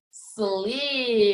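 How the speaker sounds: slim stretched with a long hummed M; say it slowly. Shown as a demonstration. Teacher's turn while listening ears are on, slowly. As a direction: draw out each sound of slim. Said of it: "I'm gonna say it slowly".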